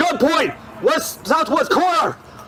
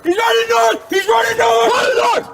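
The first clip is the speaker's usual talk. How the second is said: urgent shout; 7 semitones higher than usual. Real, not acted. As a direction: yell the direction urgently. Calling out over radio.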